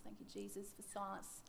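soft whisper of thanks; hushed thankful whisper; whispered prayer. Acted. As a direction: breathe a prayer under your voice. Whispered prayer in acted scene.

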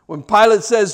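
ordinary talk

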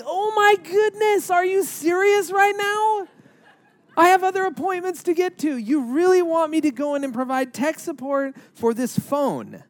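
exasperated inner thoughts. Retold or imitated.

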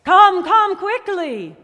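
outraged, urgent shout; horrified cry. A narrator's reading. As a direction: call out loudly in alarm. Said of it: "he was horrified".

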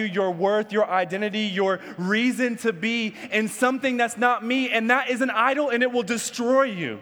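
rising from measured to high, urgent preaching; building divine rebuke. Retold or imitated. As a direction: build steadily toward a forceful warning. God's rebuke builds in intensity.

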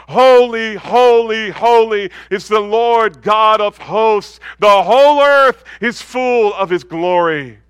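loud, slow, ringing cry of holy; proclaiming seraph. Acted. Seraph calling to the other seraph, a loud slow proclamation. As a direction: call it across heaven like a seraph.

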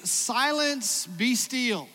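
high, loud command to the waves; Jesus rebuking the wind, commanding; commanding rebuke. Retold or imitated. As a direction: still the storm with authority. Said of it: "he rebuked the wind".

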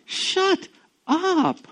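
high, loud, slow scolding; exasperated rebuke. Retold or imitated. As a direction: shut a friend down with a rebuke. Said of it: "I'm like".

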